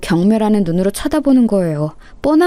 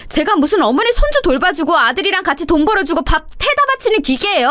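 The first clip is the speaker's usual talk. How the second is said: high-pitched yelling back, building to shrieky peaks; furious outburst; 8 semitones higher than usual. Acted. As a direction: snap and scream back at your mother-in-law, pitch climbing sharply. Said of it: "눈이 완전히 돌아서는 똑같이 소리를 질러버렸고". (눈이 돌아 높게 악쓰는 며느리)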